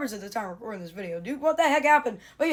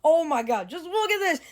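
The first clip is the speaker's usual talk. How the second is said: excited; 7 semitones higher than usual. Real, not acted. Figure with accessories thrills him.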